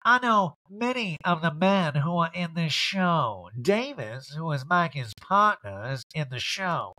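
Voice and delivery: slow, drawn-out talking-head drawl; Erica. Retold or imitated. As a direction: stretch it out in a showy impression. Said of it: "She's like".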